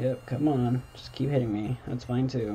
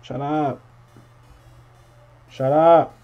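loud yell of shut up; shouting at neighbors; 3 semitones higher, 10 dB louder, slower than usual. Real, not acted. Player yelling at noisy neighbors, angry shout. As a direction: yell it down at them. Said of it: "my neighbors are yelling".

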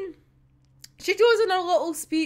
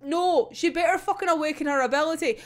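ordinary delivery

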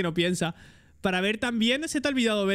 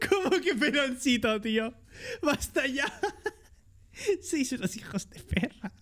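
laughing protest at a teasing nickname; 5 semitones higher, 3 dB quieter than usual. Real, not acted. Streamer laughing at chat calling him baldy. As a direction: crack up while complaining.

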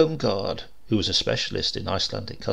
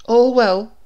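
lightly raised, louder motherly assistant voice; young woman assistant; 11 semitones higher, 9 dB louder than usual. Acted. Assistant checking on her boss, a lightly voiced young woman. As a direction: ask it brightly as the doting assistant.

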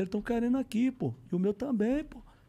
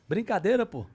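ordinary delivery

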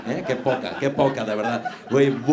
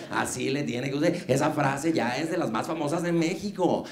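usual speaking voice

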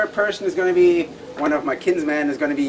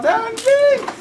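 excited squeal; 3 dB louder than usual. Real, not acted. Cold shock squeals.

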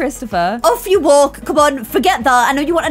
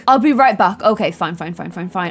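normal talking voice

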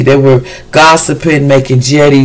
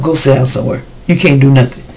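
usual speaking voice

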